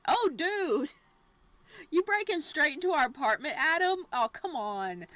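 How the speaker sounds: normal talking voice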